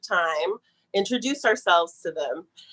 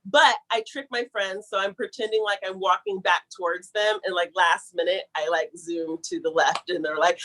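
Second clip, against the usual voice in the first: usual speaking voice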